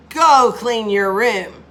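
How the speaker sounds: loud, slow, forceful order; firm shouted order, each word drawn out; exclaimed command. Shown as a demonstration. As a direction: bark it at the kid like a fed-up mom. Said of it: "it's an exclamation and a command".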